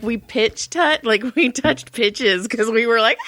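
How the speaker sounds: ordinary talk, nothing marked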